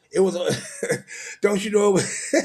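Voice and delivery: real laughter cutting off the sentence; breaking into laughter. Real, not acted. Preacher cracking up over riotous living, laughing as he talks. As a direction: start the line and crack up midway.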